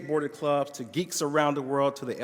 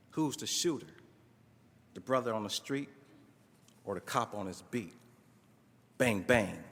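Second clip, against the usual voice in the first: spoken-word poem; 2 semitones lower, 4 dB louder than usual. Acted. Student reciting his poem to his professor, spoken word. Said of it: "Man, I wrote a poem about it".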